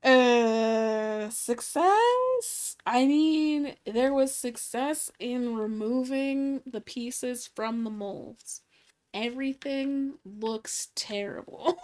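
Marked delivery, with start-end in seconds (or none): 1.3-2.8 high doubtful "success?"; unsure, rising question. Real, not acted. Doubtful reveal of failed pieces. 9.1-11.8 whispered aside; whispering. Real, not acted. Aside about ugly results.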